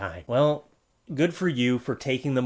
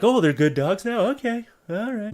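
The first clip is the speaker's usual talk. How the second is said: mock owner; 8 semitones higher, 4 dB louder than usual. Imitating the owner coming back.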